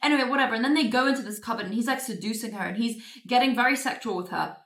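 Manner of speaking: usual speaking voice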